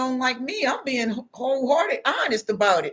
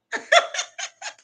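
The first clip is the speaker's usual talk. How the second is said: mocking laugh. Mock laugh of tormentor.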